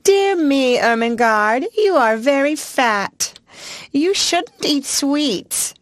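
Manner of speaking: high, slow scolding aunt voice; fussy aunts. Retold or imitated. Girl mimicking her aunts scolding her, high and slow. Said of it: "My two aunts are always saying".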